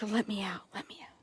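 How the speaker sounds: voicing the horse in a whisper; whispered pleading from the stall. Owner voices Peanut begging to be let out, whispered. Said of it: "Peanut's like".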